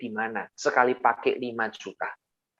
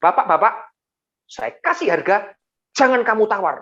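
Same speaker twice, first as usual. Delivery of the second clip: imitated sharp, raised reprimand; loud scolding; 8 semitones higher, 5 dB louder than usual. Mall manager snaps at the pastor about bargaining, loud scolding. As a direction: cut him off loudly, higher-pitched. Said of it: "dibentak sama G M-nya dengan suara yang keras".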